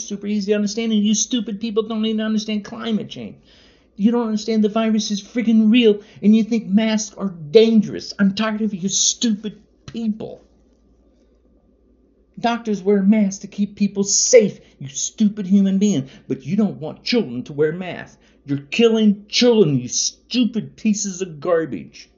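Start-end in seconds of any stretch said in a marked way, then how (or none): 1.0-10.4 high, loud, fast furious rant; angry shouting. Real, not acted. Fed-up man yells at anti-mask Trump supporters, furious. 12.4-22.1 high, loud scolding yell; angry shouting. Real, not acted. Angry man scolds people who refuse children masks, shouting. Ranting about kids and masks.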